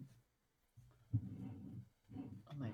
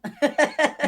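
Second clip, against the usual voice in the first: high burst of laughter; loud laugh; 12 semitones higher, 15 dB louder than usual. Real, not acted. Big laugh at joke.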